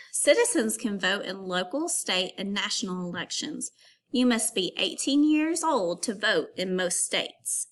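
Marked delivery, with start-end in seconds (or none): none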